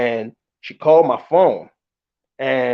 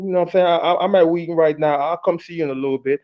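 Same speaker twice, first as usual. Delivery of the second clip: ordinary talk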